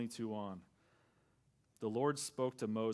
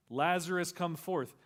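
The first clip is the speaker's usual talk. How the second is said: louder, higher call into the tomb; commanding call; 7 semitones higher, 8 dB louder than usual. Acted. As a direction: call the dead man out with authority. Jesus calls Lazarus from tomb.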